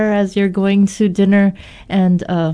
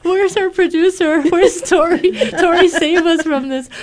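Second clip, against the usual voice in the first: much higher, giggly cry for help; laughing mock plea; 10 semitones higher than usual. Real, not acted. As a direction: call for rescue through laughter, pitched high. Mock plea for rescue while laughing.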